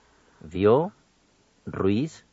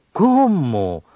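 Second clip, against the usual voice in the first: louder "¿Cómo?" falling in amazement; exclamation; 3 semitones higher, 5 dB louder than usual. Shown as a demonstration. Radio teacher demonstrating admiración, exclaiming. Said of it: "en preguntas o admiración".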